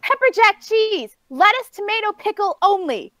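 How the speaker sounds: exasperated rant. Real, not acted. Customer ranting about a botched simple burger order, exasperated.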